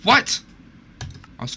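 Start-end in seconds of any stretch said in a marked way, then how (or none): 0.0-0.4 sudden high loud what; shocked yelp. Real, not acted. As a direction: yelp it in disbelief. Shocked outburst mid-game.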